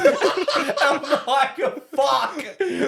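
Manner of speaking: very high, cracking up; laughing dismay. Retold or imitated. Prank victim reliving the moment, laughing in dismay. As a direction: squeal the curse through laughter. Retells realising he was pranked.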